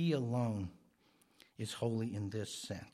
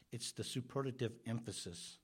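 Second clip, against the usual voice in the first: normal talking voice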